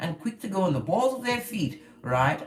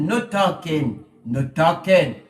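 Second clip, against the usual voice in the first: put-on deep, slow Eastern European accent, louder; 8 dB louder, slower than usual. Repeating the warning to the jealous person in an Eastern European accent. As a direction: drag it out in a gruff Eastern European voice. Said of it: "in a deep European, Eastern European accent".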